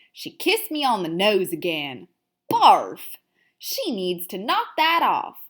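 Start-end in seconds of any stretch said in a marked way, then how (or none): 2.5-3.2 a loud, hammed-up barf; loud mock disgust. Hamster after the girl's kiss, loud mock gag. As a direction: spit out your disgust. Kissed on nose.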